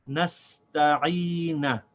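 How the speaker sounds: "Nasta'ina" drawn out very slowly; very slow model. Shown as a demonstration. Teacher slowly modelling the whole word "Nasta'ina". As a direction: stretch out "Nasta'ina" for the learner. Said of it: "So this is pronounced".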